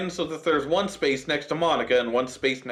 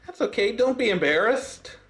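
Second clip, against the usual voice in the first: put-on reassuring girl voice; 7 semitones higher than usual. Monika character voice.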